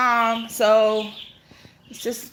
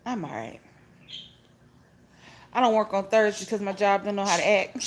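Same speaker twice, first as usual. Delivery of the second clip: usual speaking voice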